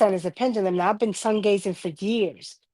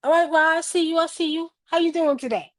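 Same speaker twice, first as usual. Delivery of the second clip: higher, cheerful greeting to the sun; playful greeting; 8 semitones higher than usual. Retold or imitated. Speaker greeting the sun Ra after it burns his face, bright and playful. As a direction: call up to the sun like an old friend. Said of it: "I'll be like".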